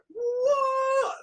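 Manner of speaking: very high, loud stunned yelp; shocked squeal. Retold or imitated. DJ realising it really is [name], shocked squeal. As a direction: squeal it out in disbelief. Acted shocked reaction to caller.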